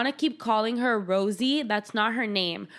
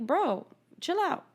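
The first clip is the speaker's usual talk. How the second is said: exasperated chill out; 8 semitones higher than usual. Real, not acted. Talking back to Edward on screen, exasperated. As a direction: tell him to calm down.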